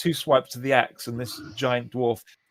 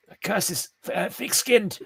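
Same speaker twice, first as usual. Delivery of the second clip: higher-pitched acted exasperation; frustrated spellcaster; 9 semitones higher than usual. Dwarf's curse fails on the Minotaur, acted exasperation. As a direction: sputter it in a raised character voice. Caster complains curse failed.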